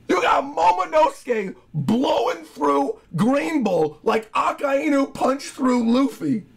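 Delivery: ordinary talk, nothing marked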